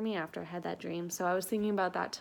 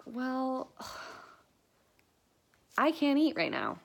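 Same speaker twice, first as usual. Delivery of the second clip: sighing, higher, put-upon complaint; a sigh, then a higher whiny I can't eat; whiny refusal; 5 semitones higher than usual. Retold or imitated. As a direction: groan it out like you're too queasy to eat. Self-quote refusing food to mom.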